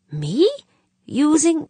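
shocked questions leaping up in pitch; genuinely shocked. A narrator's reading. Chair accused of using a colleague, genuinely shocked. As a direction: echo the accusation in rising disbelief. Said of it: "O'Banion was genuinely shocked".

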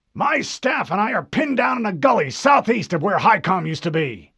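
high, loud, demanding officer voice; angry admiral. Acted. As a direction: bark like an impatient admiral. Admiral explains.

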